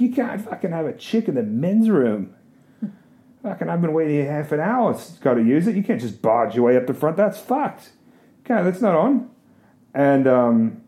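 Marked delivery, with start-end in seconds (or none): 0.0-9.3 angry bloke in line. Retold or imitated. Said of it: "he's just like".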